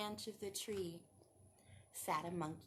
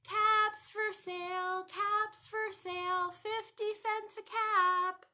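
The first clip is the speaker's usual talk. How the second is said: loud, slow street call; peddler calling; 9 semitones higher, 8 dB louder, slower than usual. Acted. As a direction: cry it out to the whole street.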